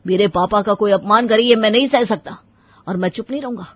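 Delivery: loud, indignant protest; angry defiance. Acted. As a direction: stand up firmly for a father. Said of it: "उसका गुस्सा और भी बढ़ गया".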